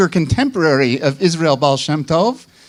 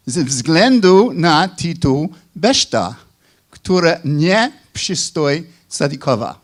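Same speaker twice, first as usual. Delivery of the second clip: careful Polish read with a Chicago accent; deliberate foreign-language reading, Chicago-accented. Real, not acted. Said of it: "Please forgive my Chicago accent".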